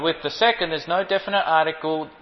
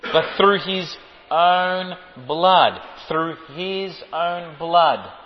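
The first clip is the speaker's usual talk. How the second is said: slow, each word weighed: "through his own blood"; slow emphatic repetition; much slower than usual. Shown as a demonstration. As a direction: drive "his own blood" home slowly. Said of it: "Did you see that?".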